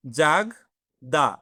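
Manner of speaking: জাগদা said very slowly with a throaty French R like Bengali গ; slow-motion জাগদা, leaning on the R; French R demo. Shown as a demonstration. Teacher showing students the French R in জাগদা, slow. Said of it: "আমি আর এর উচ্চারণটা বলে দিই".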